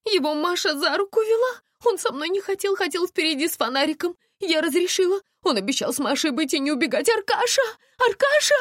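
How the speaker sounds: high, anxious explaining that rises to a call; distraught high voice, ending in shouts; frantic mother. A narrator's reading. Мать ищет пропавшего сына.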